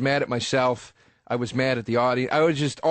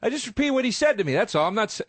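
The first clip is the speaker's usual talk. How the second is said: higher, fast and defensive; comic explaining himself to the heckler; defensive protest; 6 semitones higher, faster than usual. Retold or imitated. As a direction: protest your innocence in a rush. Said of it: "I was going".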